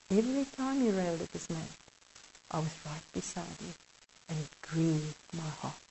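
sorrowful Jesus. Acted. Jesus tells the kneeling man his deals saddened him, softly grieving. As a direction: let the sadness sink into a near hush.

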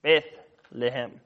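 Bethlehem slowly split as Beth, pause, lehem; split into parts. Shown as a demonstration. Preacher breaking down the word for listeners, Bethlehem slowly in two parts. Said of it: "The word".

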